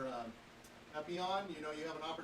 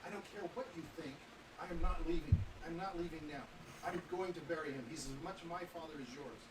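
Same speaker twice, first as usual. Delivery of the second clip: ordinary talk, nothing marked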